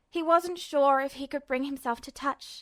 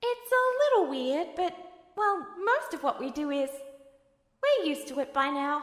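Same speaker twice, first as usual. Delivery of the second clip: put-on a kind, patient kid brother explaining; higher gentle boy voice; gentle younger brother Al; 3 semitones higher than usual. Al speaks to Ed.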